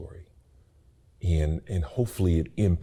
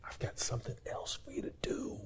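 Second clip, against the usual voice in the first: whispered voice of God; 14 dB quieter, faster than usual. Retold or imitated. God's voice in a whisper.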